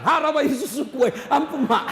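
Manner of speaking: loud laughing mock outburst; mock yell. Mother's outburst and son begging mercy, loud laughing. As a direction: blurt it out half laughing. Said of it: "Langsung dia bilang".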